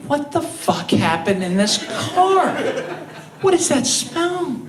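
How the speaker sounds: high, appalled mock outcry, slowing on the last words; hammed-up disgust at a car's stink; disgusted stranger. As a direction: play a driver gagging at a mystery smell. Imagined driver's disgusted outburst.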